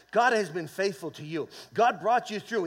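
ordinary delivery

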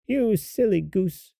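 high, slow witch scolding; hag. A narrator's reading. Hag scolding Gretel at the oven, high and slow. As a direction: chide her in a high hag voice. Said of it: "said the hag".

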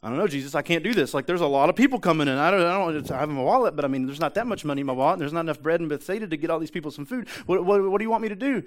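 acted frantic pleading, rising in pitch at the end; flustered panic. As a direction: babble excuses like an overwhelmed disciple. Acts out Philip panicking to Jesus.

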